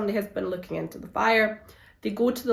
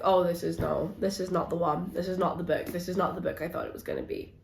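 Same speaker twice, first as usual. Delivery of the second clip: deflated; 3 semitones lower, 7 dB quieter, faster than usual. Retold or imitated. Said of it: "I was like".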